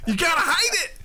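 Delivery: high pressing "you gotta hide it" twice; urgent mock plea. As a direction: urge her, half laughing, to keep a straight face. Urging coworker to hide laughter.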